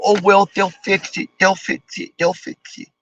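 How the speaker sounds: fading off into a mumble; mocking complacent shrug. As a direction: mimic a lazy shrugging voice that fades away. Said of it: "all you can do is sit there and go".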